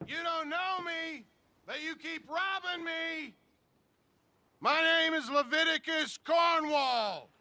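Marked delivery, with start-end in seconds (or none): 4.6-7.3 furious shout. Acted. Wronged businessman calling out the robber, furious shout. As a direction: bellow the name and the threats, full force. Cornwall yelling for Vanderlyn outside.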